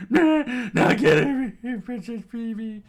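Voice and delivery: high, very loud cackling villain; screaming bandit. A narrator's reading. Bandit lunging to grab the princess, shrieking. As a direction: shriek it slowly with a mad laugh. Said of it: "the bandit screamed out".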